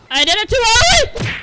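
high, loud outcry; yelling in dismay. Real, not acted. Gamer mistiming the start, high dismayed yell. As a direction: wail it loudly up high.

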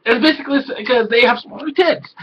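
ordinary talk

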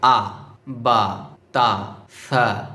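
"আ, বা, তা, সা" slowly, each syllable drawn out; slow model recitation of "আ, বা, তা, সা"; letter reading demo. Shown as a demonstration. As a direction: read "আ, বা, তা, সা" one long syllable at a time. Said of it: "খেয়াল করুন কিভাবে পড়ছি".

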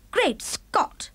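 a royal gasp of shock; shocked cry. Acted. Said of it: "cried the famous voice".

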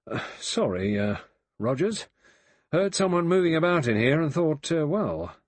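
embarrassed butler-caught excuse; flustered apology. A narrator's reading. Blore caught snooping, stammers to Rogers, flustered.

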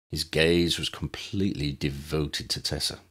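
plain reading voice

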